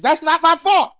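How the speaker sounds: high-pitched, worked-up objection; indignant protest. Acted. Son disputing mom's whipping logic, high indignant protest. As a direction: argue back like a wronged kid. Argues against mother's saying.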